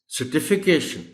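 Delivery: certification with stress on the "ti"; stress after CER. Shown as a demonstration. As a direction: model certification as "ser-TI-fi-cation". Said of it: "CER के बाद जो टी है". (certification میں ti پر زور)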